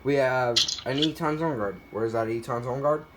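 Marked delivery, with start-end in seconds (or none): none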